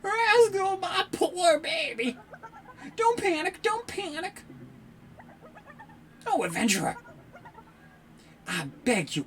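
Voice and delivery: high, pleading mother voice; worried mother. Acted. Mother begging the adventurer for help, high pleading. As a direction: beg the hero to save her pet.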